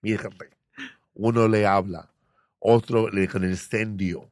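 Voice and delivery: put-on Spanish with a Cuban accent. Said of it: "speaking in Spanish with a Cuban accent".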